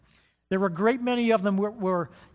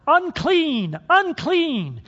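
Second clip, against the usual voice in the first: high, loud cry of "Unclean!"; shouted warning; 8 semitones higher, 7 dB louder, much slower than usual. Retold or imitated. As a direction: call it out loud to warn the road. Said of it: "they had to shout".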